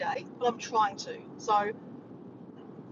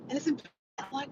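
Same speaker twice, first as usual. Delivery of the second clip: normal talking voice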